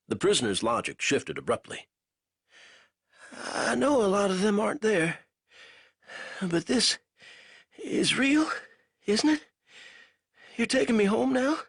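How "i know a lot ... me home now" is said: put-on raised, strained young soldier's voice; a weak captive fumbling for words; lightly voiced delirious prisoner; feverish prisoner. A narrator's reading. Banks voice, pleading.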